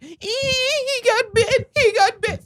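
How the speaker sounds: very high, drawn-out mock shriek; imitated freak-out. Retelling a woman whose little dog got bit, mock panicked squeal. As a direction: squeal it in exaggerated panic, far above your voice. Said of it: "freaking out".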